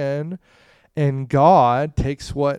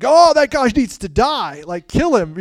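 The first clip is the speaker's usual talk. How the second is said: high, loud indignant outburst; hammed-up righteous fury; acted outrage; 10 semitones higher, 9 dB louder than usual. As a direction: play the outraged king passing judgment. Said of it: "David, hears the parable, and he's like".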